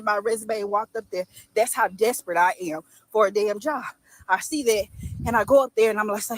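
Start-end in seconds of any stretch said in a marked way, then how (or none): none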